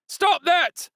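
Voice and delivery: high loud shout from the crowd; yell. A narrator's reading. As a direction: shout to stop him. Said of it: "one man yelled".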